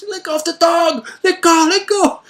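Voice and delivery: high, very loud repeated yelling; father's desperate high shout at his son; retold frantic scream. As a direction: scream over and over to make him drop it. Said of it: "shouting his name".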